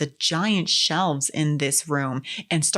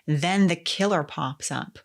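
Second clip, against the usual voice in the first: ordinary delivery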